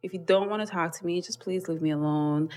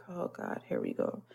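weary groan; 2 semitones lower, 12 dB quieter than usual. Retold or imitated. Inner groan at tattoo question.

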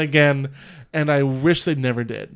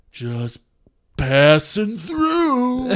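off-the-hook delivery with a long, high, wailing finish; Nicolas Cage; 6 semitones higher, much slower than usual. Retold or imitated. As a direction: pause after the first word, then stretch it out wildly. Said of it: "Nicolas Cage just going off the hook".

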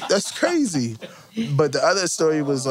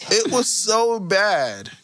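"so bad" stretched out slow for effect; drawn out slowly; 7 semitones higher, slower than usual. Real, not acted.

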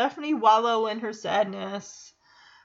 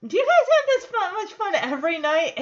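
much higher, louder and quick; perky question; 7 semitones higher, 5 dB louder, faster than usual. Retold or imitated. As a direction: gush with bright enthusiasm. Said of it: "And Samantha asks".

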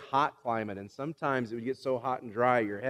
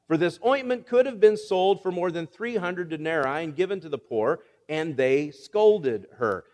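scripture reading; 3 semitones higher, 4 dB louder than usual. Acted.

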